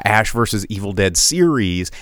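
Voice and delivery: ordinary delivery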